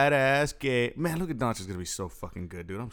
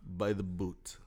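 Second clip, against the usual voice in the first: put-on Russian accent; 4 semitones lower, 6 dB quieter than usual. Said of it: "you be Khabib".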